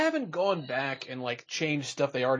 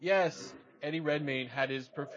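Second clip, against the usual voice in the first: ordinary talk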